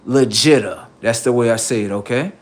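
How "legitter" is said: Legitter said as le-jit-er, falling. Shown as a demonstration. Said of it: "You know how you say legit?".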